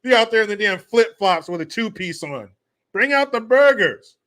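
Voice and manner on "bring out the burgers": louder, higher, slow, a mock party holler; hammed-up cookout call. Mocking Minnesotans grilling in the cold, hammed-up holler. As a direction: belt it like a summer barbecue.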